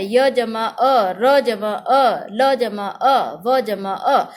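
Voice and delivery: very slow, letter-by-letter breakdown; slow spelling out. Shown as a demonstration. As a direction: draw each piece out for the class. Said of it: "सहायता दे रहा है". (बहुत धीरे, अक्षर-अक्षर तोड़कर)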